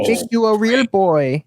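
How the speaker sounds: put-on high, slow Italian-accented old woodcarver; Italian Geppetto voice. Mocking Tom Hanks as Geppetto, high slow Italian accent. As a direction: ham up a doting Italian old man, drawn out. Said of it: "he made one with an Italian accent".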